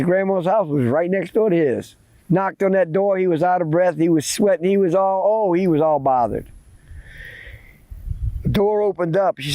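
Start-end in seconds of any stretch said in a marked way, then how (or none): none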